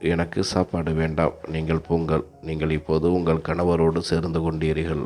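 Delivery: usual speaking voice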